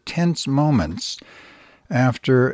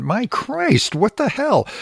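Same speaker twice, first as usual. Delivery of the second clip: real disbelief; 10 semitones higher, slower than usual. Real, not acted. Host reacting to the story.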